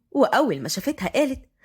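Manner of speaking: ordinary delivery, nothing marked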